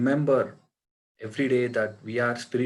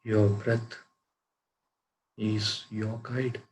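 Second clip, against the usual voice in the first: guided meditation. Acted. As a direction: guide them into calm, unhurried, phrase by phrase. Leads a breath meditation.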